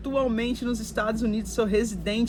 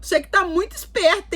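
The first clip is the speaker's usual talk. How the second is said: pitched-up acted scolding; imitated suspicious shopkeeper; 8 semitones higher than usual. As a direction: mimic a vendor wary of a cheeky child. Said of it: "Aí o cara".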